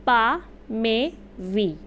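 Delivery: पा, मे, वी said slowly one by one; slow vowel demo. Shown as a demonstration. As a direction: spell out each vowel sound. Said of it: "तो हमने देखा".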